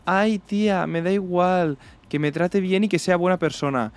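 high, gushing mock voice; mock girl voice. Mocking a girl telling her friend she only wants a good guy, high and gushing. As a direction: play the girl who claims she only wants kindness. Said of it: "Es como".

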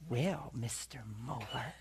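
slow, low, hushed villain voice; soft, creepy cartoon-villain greeting; sinister cartoon character. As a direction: imitate a sinister nurse, drawing it out. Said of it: "some sinister character".

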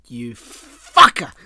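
very loud, drawn-out curse; mock outraged shout. Teased friend hits back at co-host, mock outraged shout. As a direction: stretch it out and explode in pretend outrage.

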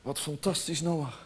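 very soft, low and hushed; deeply moved. Acted. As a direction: murmur it low, full of awe. God moved by Noah's faith. (diep ontroerd, zacht en laag)

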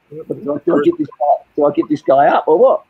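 high-pitched, mock dilemma; imitated torn questioning. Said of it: "She was like".

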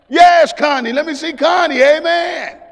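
loud high insistent reply; eager shout. Retold or imitated. Preacher shouting his young self's eager answer. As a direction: insist on it at the top of the voice.

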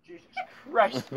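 usual speaking voice

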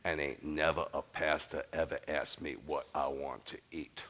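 put-on low, slow old Southern lady voice; imitating elderly churchwoman. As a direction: draw it out like an aged churchgoer. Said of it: "this is what she said. She goes".

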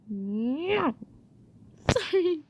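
ordinary talk

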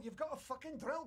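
ordinary talk, nothing marked

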